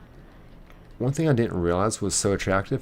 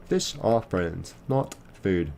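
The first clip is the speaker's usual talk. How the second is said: slow, deliberate quoting of the line; slow movie quote; slower than usual. Retold or imitated. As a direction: savour each word like a famous catchphrase. Quoting Finding Nemo line.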